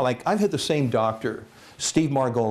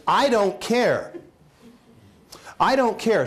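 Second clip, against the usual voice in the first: high, insistent mock dismissal; acted defiant wife; emphatic wife; 8 semitones higher than usual. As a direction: brush off the objection, pitch raised.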